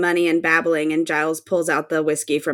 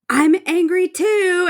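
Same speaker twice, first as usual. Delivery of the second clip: high, slow mock Anya whine; high, drawn-out imitation of Anya; mimicking Anya; 11 semitones higher, slower than usual. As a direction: squeak it out like a sulky Anya. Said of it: "Anya's like".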